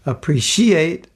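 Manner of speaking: slow "appreciate" with a syllable leaned on and stretched; accented syllable. Shown as a demonstration. Speaker testing whether Americans accent syllables, stretched questioning "appreciate". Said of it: "Did I ever accent syllables?".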